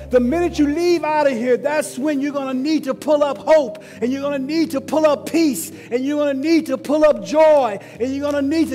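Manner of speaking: preaching at full force. Acted. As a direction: drive it home to the congregation.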